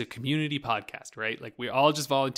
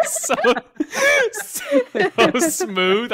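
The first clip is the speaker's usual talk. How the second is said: high laugh through words; laughing in delight; 10 dB louder, much slower than usual. Real, not acted. Laughing at a voice clip.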